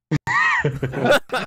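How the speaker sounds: loud, high burst of laughter. Real, not acted. Co-host cracking up at a silly name, loud laugh.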